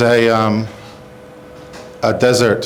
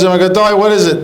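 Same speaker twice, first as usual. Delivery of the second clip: anxious pleading; 6 semitones higher, 3 dB louder, faster than usual. Acted. Anxious patient begs doctor for answer.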